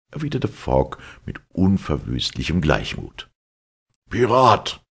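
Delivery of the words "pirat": loud, higher-pitched yell of the word; shouted insult. A narrator's reading. Captain yelling at Fogg, furious shout. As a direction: spit the accusation out. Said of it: "schrie Andrew Speedy".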